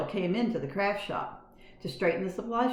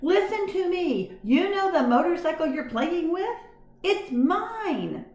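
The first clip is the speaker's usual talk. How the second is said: high, loud slow squeak; loud mouse voice; 12 semitones higher, 5 dB louder, slower than usual. A narrator's reading. Mouse shouting to the boy about the motorcycle. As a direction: make the boy listen at full volume. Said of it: "as loud as he could".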